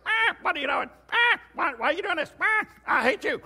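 frantic, very high parrot shrieks; squawking parrot. Acted. Parrot shut inside the freezer, high squawking protest. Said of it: "you could hear the parrot going".

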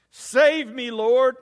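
loud, high cry for rescue; a desperate, loud plea; shouting for help. Acted. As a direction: yell as if you're sinking. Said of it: "he shouted".

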